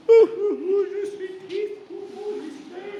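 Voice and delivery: high wailing sobs, hammed up; exaggerated fake boo-hoo; imitation crying. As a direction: overdo the crying like a clown. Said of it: "mon imitation de pleurage".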